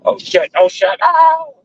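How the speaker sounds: sudden high alarmed yelp; startled yell. Real, not acted. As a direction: blurt it out in shock. Sudden alarm while driving.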